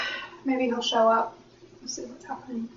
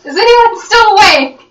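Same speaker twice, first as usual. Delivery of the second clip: calling out in character; 10 semitones higher, 9 dB louder than usual. Retold or imitated.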